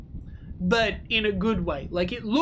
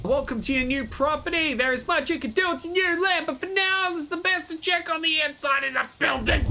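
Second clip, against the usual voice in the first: higher announcer-like NPC voice; character voice; 8 semitones higher than usual. Acted. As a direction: read the welcome text in character. Reads tutorial text in voice.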